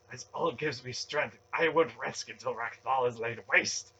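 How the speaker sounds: fervent, loud stage mutter; fervent vow. A narrator's reading. Zealot vowing to lay the city waste, fervent vow. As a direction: vow it intensely, pushing every word. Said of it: "Relm vowed in a fervent mutter".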